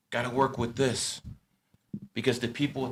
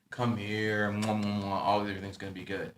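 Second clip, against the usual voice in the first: soft comforting; 4 semitones lower, 7 dB quieter than usual. Acted. Comforting a kid who hit his head.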